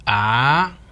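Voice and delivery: "Ah" climbing with a tightening throat; rising tone. Shown as a demonstration. Teacher showing the throat tightening, "Ah" rising. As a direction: squeeze "Ah" upward. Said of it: "as your throat gets tighter".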